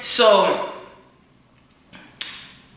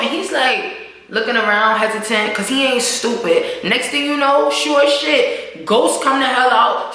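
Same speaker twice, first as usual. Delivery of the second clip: ordinary talk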